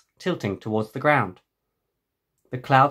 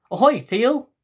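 loud, high hail across the harbour; cheerful call; 11 semitones higher, 6 dB louder than usual. Acted. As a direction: call out to a friend from the bike. Greeting friend while riding.

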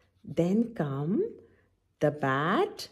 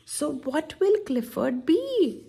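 plain reading voice

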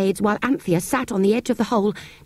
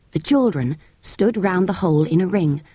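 ordinary delivery

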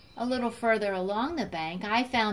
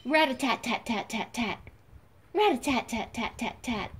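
woodpecker drumming; 3 semitones higher than usual. Shown as a demonstration. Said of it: "Let me give you a hint".

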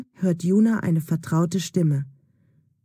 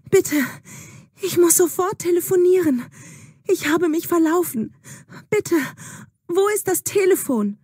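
high, urgent begging for a phone; frightened girl begging at a stranger's door; desperate pleading; 11 semitones higher than usual. Acted. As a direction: plead breathlessly, much higher, lost and scared. Lost Juna begs at hut door.

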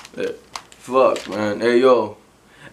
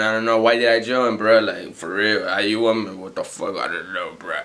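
much lower, slow, dazed mumble; spaced-out pothead; 9 semitones lower, slower than usual. Acted. Pothead who just joined the party, mumbling low and dazed.

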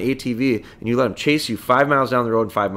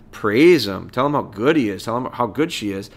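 ordinary talk, nothing marked

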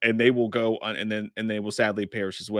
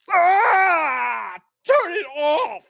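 very high screamed impression; drawn-out scream in a put-on voice; Shredder impersonation; 6 dB louder, much slower than usual. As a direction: shriek it, hammed up to the limit. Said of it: "impersonation of Shredder".

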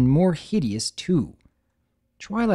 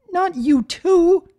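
much higher, louder, slow wail; sobbing cry; 7 dB louder, slower than usual. Acted. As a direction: wail it through tears. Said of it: "She sobbed openly".

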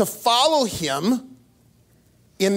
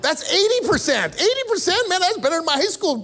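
eighty percent said higher and higher; excited; 10 semitones higher than usual. Real, not acted. Pastor delighting in his kids being found, excited. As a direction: pitch climbing with delight.

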